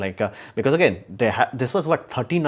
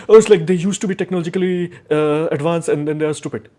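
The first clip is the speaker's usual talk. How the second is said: 1 mock future historian; 4 semitones higher, 5 dB louder than usual. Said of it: "what will you say in the future".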